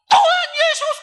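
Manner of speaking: high loud cry of the name; shouting. Retold or imitated. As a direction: burst out with the cry. Mute woman's first cry. (teriakan tinggi memanggil Tuhan)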